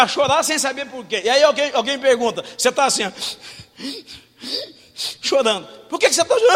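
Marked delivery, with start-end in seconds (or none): 5.9-6.6 high, loud questioning voice; a concerned friend asks, high and loud; mock asker. A friend asks the crying one why, high and loud.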